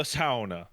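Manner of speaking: "sauna" as SOW-nah, first syllable rhyming with cow; Finnish sauna. Shown as a demonstration. Gamer enjoying the Finnish word, "sauna" said as SOW-nah. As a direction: give "sauna" its Finnish OW vowel. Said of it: "way more fun".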